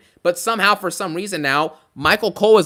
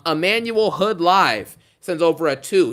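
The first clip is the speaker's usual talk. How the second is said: ordinary talk, nothing marked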